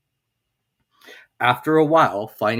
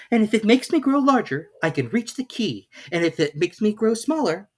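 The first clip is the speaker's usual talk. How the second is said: put-on much higher girlish reasoning; a girl talking herself into it; Alice's voice; 11 semitones higher than usual. A narrator's reading. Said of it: "said Alice".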